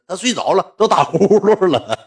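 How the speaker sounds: slow words broken by laughter; laughing. Real, not acted. Telling how boss fell asleep snoring, laughing. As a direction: crack up while saying it.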